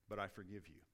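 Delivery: low, soft, sincere; hushed, low words of forgiveness; quiet forgiveness. Acted. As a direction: forgive softly, with the voice dropped. Voicing wife's solemn forgiveness.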